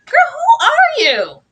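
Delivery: a high cry of disbelief at Mary; exasperated outburst. Real, not acted. Host fed up with Mary making Matthew wait, loud high disbelief.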